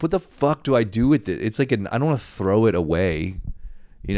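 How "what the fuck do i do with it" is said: higher, louder baffled outburst; exasperated. Retold or imitated. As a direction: throw up your hands at the gift. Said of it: "I'm just like".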